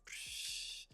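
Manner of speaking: soft hushing 'psh' noise; imitated little explosion of air; mind-blown whoosh. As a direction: let out a quiet airy burst.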